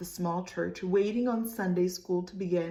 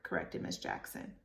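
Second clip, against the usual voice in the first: usual speaking voice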